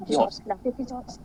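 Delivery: कीहॉफ्स drawn out slowly and softly, no r; slow, careful German कीहॉफ्स; teacher models the German name कीहॉफ्स; German pronunciation. Shown as a demonstration. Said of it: "उसका actual नाम क्या है German में?".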